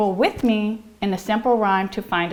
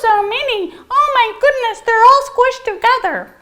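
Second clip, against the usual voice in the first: high excited gush; 13 semitones higher, 4 dB louder than usual. Acted. Excited piano teacher gushing.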